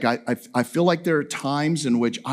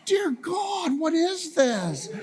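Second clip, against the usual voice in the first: much higher, slow mock-horrified cry; mock exasperated outcry; 10 semitones higher, 3 dB quieter, much slower than usual. Husband finding a new Amazon package, high mock-horrified cry. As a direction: throw up your hands at yet another package. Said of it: "I'm like".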